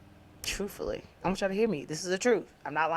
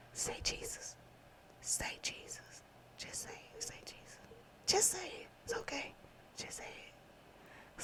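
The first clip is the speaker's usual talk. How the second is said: hushed, breathy coaxing whisper; 15 dB quieter than usual. Retold or imitated. A spirit urging her to say Jesus, whispered. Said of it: "they was like, Whisper, like, say Jesus".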